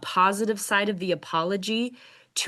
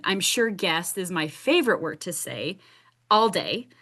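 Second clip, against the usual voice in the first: normal talking voice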